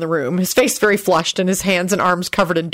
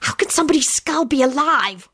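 high, whispery hiss; 8 semitones higher than usual. A narrator's reading. Rosie asks Lupin about the living skull, hissing. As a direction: hiss it in disbelief. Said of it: "Rosie hissed".

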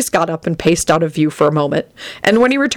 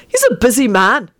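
higher, mock-reasonable tone; dryly mocking, pitched up; sardonic; 6 semitones higher than usual. A narrator's reading. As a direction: intone it sardonically. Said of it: "Benji intoned sardonically".

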